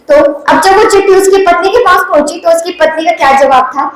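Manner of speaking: usual speaking voice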